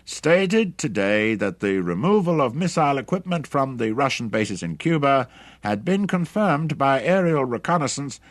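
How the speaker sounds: high, loud newsreader delivery; loud radio announcer. A narrator's reading. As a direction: project it like an announcer reading a bulletin. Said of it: "announcer's voice came through loudly".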